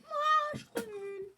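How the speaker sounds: high-pitched self-mocking squeal; high squeaky whine. Real, not acted. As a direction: squeak it out in frustration. High squeal after death.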